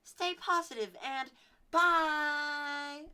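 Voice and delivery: high, loud, slow sign-off; squeaky-high and loud childish catchphrase; kid outro voice. Shown as a demonstration. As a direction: sing it out like a kid vlogger. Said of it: "Like, I would".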